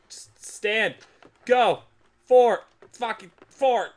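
loud, high straining yells; frustrated shouting. Real, not acted. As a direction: yell at the game in effort. Straining at game controls.

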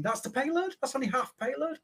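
high-pitched mock disbelief; incredulous reaction. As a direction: rise high, as an astonished onlooker would. Voices an onlooker's astonished thought.

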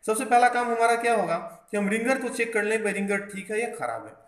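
ordinary talk, nothing marked

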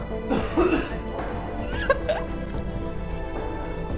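choking cough from spicy food; harsh cough after chili; coughing fit. Real, not acted. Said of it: "Raga, brucia!". (tosse soffocata dal peperoncino)

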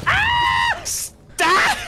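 sudden high yell to stop; startled shout. Real, not acted. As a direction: shriek it, real alarm. Startled by song, yells stop.

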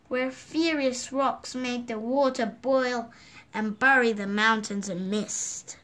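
ordinary delivery

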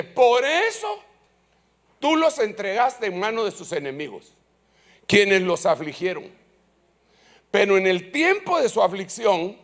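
loud, high declaiming of scripture; scripture reading. Acted. Preacher reading Nehemiah to the congregation, loud and high. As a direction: proclaim the verse to the church.

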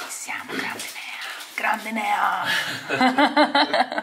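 whispered cheering; whispering. Real, not acted. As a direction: cheer under your breath. Incita il montatore sottovoce. (sussurrando con un sorriso)

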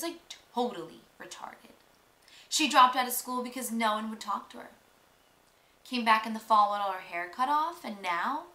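normal talking voice

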